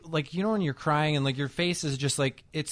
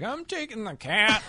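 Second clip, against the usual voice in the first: higher, slow matter-of-fact; deadpan; 8 semitones higher than usual. Retold or imitated. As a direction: calmly announce it, rising at the end. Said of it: "And I was like".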